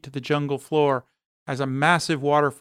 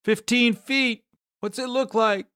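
calling up; 8 semitones higher than usual. A narrator's reading. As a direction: call up to the climber high above. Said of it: "Oscar calls up".

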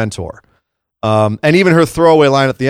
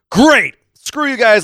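higher-pitched mock "fine then" defiance; retold exasperated outburst; 9 semitones higher, slower than usual. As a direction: act out a fed-up retort, pitched up. Retold angry sarcastic outburst.

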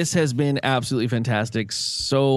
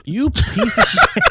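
high, drawn-out comic insult; mock outrage; 10 semitones higher, 4 dB louder, much slower than usual. Host learns recording was cut ten minutes ago, mock outrage. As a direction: stretch it out, pitched way up.

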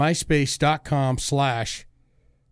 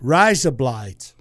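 slow, lower mock Australian; Australian accent; 4 semitones lower, much slower than usual. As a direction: copy the Australian vowels, drawn out. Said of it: "in Australian".

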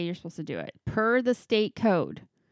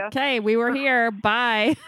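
louder, laughing mock farewell; mock breezy goodbye; 4 semitones higher, 5 dB louder than usual. Travelers passing straight through a dull state, joking loud goodbye. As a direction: toss off a quick tourist's goodbye, louder.

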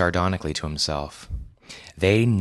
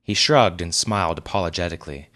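ordinary delivery

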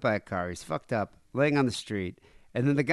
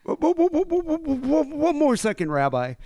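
stuttering plea; 13 semitones higher, 4 dB louder than usual. Retold or imitated. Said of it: "the guy would be like".

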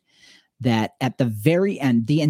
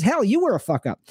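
teasing jab; 9 semitones higher than usual. Retold or imitated. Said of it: "She goes".

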